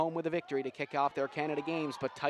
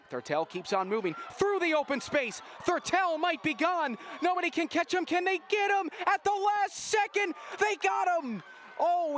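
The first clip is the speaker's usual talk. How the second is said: rising excited play-by-play; 10 semitones higher than usual. Acted. Commentator calling a breakaway try, excited and rising. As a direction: call the run to the line, climbing with the action.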